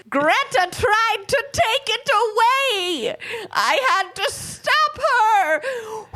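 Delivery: very high, slow melodramatic wail; dying ancient man. Acted. Podcaster voicing the killer's confession, high slow dying wail. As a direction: wail it as your last breath before turning to dust. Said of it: "disappeared into dust".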